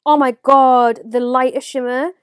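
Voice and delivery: loud, thrilled gushing; loud excitement. Real, not acted. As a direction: rave about it at full volume. Raving over shimmer.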